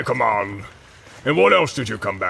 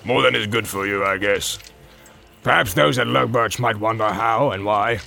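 barking orc commands. Acted. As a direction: shout the troops onward. Ugluk commanding.

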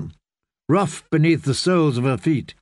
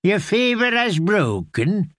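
put-on light Free Cities accent; 6 semitones higher than usual. A narrator's reading. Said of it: "a voice flavoured with the accents of the free cities".

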